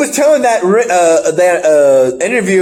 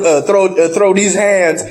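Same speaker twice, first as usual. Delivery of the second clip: ordinary talk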